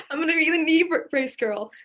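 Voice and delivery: laughing through the words, high and loud; high laughing mock brag. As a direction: giggle out the plan. Laughing through her own plan.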